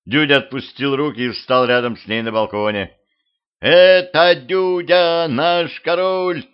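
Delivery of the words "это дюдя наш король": high, slow, carrying shout; crowd cry. A narrator's reading. People spot their king on the balcony, shouting. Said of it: "закричали люди".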